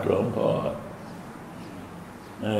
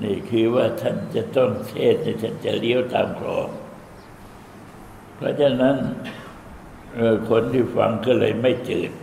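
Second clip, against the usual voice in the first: ordinary delivery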